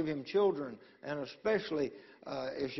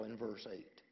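ordinary delivery

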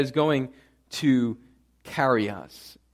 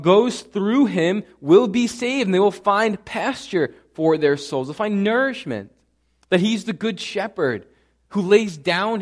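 loud, rising sermon climax, each claim hammered; preaching at full force; 6 semitones higher, 6 dB louder than usual. Acted. Pastor proclaiming Christ's I am sayings to the church, preaching at full force. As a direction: preach it out to the whole congregation.

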